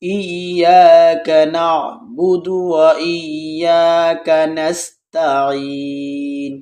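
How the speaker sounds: slow, drawn-out tajweed chant; slow melodic Quran recitation. Shown as a demonstration. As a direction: intone each word slowly. Full recitation from the start.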